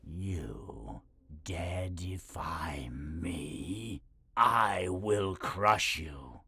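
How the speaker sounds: slow whispered menace, then rising; dark lord. Acted. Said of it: "dark lord".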